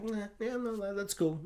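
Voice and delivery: small, high, soft reply; underwhelmed little mock reassurance; feigned nonchalance. Acts out underwhelmed reaction to cameo.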